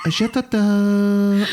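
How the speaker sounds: slow, high hammed French; French accent. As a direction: drawl it in mock French. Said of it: "this accent".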